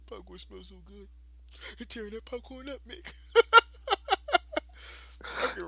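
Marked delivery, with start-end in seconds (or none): 0.1-3.1 much softer, half-laughing mimic of a whiny kid; quiet kid brother imitation. Repeating his brother's line to a friend, soft amused mimic. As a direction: retell it softly, still amused. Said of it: "He's like".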